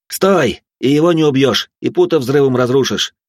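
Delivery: sharp, loud warning; urgent shout. Acted. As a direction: yell it to stop her.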